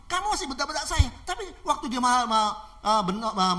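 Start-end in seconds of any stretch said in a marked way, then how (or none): 0.1-1.1 high, indignant complaint; acted outrage at being yelled at; angry robber. Robber objecting to the pastor's orders, angry. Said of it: "ini perampoknya mulai marah-marah".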